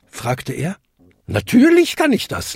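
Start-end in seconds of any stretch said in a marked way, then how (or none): 1.3-2.6 high, emphatic swoop; amused. A narrator's reading. Godmother boasting of her magic, amused. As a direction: exclaim it high as if it were obvious. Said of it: "lachte sie".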